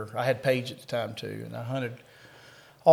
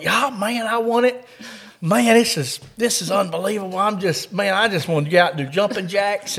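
high, loud retold gushing; imitated overjoyed excitement; 5 semitones higher, 5 dB louder than usual. Buddy telling the cop he won, imitated elation. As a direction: play the buddy bursting with joy.

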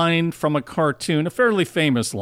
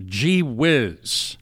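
drawn-out gee whiz; 4 semitones lower than usual. Acted. Examples of minced oaths.

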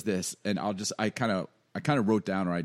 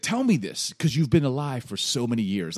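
God; 3 semitones higher, 6 dB louder than usual. Acted. God mocking Job's short life, loud taunting challenge. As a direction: needle him with exaggerated emphasis. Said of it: "this is God kinda mocking".

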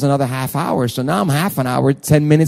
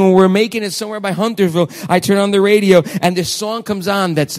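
normal talking voice

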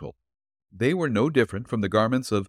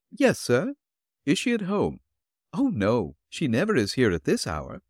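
put-on higher, gentle old woman's voice; elderly landlady voice; 5 semitones higher than usual. Landlady answering the detective at her door, politely.

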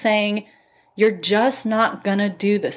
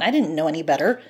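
ordinary talk

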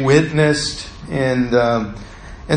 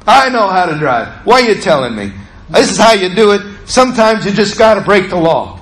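angry retort; 4 semitones higher than usual. Retold or imitated. Bus driver snapping back at his ride-along critic, angry and insistent. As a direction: retell it as a heated protest. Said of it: "I was angry".

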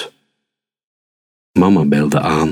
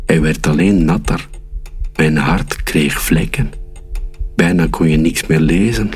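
usual speaking voice

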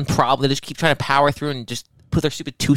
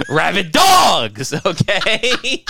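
mock shout; 7 semitones higher, much slower than usual. Real, not acted. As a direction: drag out rabid dogs as a shout. Mocking own rant, shouted.

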